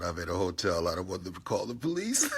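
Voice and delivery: usual speaking voice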